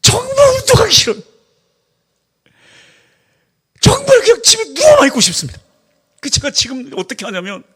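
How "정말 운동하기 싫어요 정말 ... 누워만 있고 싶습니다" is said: whiny. Real, not acted. Whining about hating exercise.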